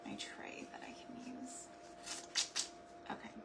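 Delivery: whispered aside to herself. Real, not acted. Whispering to herself while she works. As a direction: murmur it under your breath.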